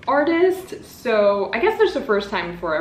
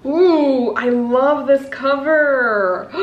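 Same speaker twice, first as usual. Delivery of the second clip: loud slow gush; 4 semitones higher, 5 dB louder, much slower than usual. Real, not acted. Gushing over cover.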